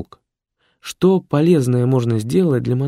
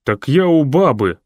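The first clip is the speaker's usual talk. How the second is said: perking up; 5 semitones higher, 6 dB louder than usual. A narrator's reading. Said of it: "ожил садист".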